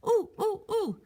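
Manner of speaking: high, excited ooh; high sing-song ooh, ooh; eager ooh. Acted. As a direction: bounce with a bright idea. Lewis has an idea.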